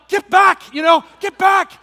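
loud, high frightened yell to get back; panicked yell. Retold or imitated. Dad spotting a snake on the driveway, yelling in panic. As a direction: shout it like a snake just appeared at your feet.

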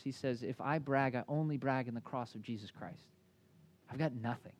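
soft heartfelt testimony; quiet sincere confession. Real, not acted. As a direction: bring it down to a humble hush. Quiet earnest preaching close.

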